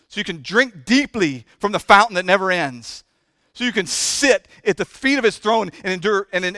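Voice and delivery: loud, driving sermon climax; loud pulpit fervor building to a cry; full-force preaching. Acted. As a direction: preach it hard to the congregation.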